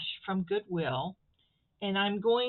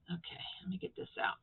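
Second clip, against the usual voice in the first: straining under breath; 10 dB quieter, faster than usual. Real, not acted. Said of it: "My fingers aren't working".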